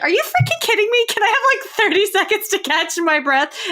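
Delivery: high, fast, exasperated disbelief; fast, high-pitched mock outrage at the request; incredulous disbelief. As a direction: act out being floored by the request. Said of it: "And I was like".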